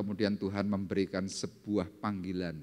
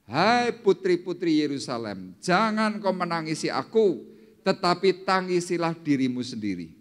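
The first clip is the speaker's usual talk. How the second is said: high, loud, slow proclamation; declaimed scripture quote; 7 semitones higher, 6 dB louder than usual. Acted. Jesus to the daughters of Jerusalem, loud declaiming. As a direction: call it out to a whole crowd.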